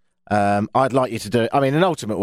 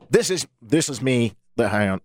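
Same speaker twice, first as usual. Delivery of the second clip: usual speaking voice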